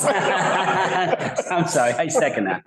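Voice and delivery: apologizing through laughter; laughing. Real, not acted. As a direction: giggle out the words. Laughing after missing the second.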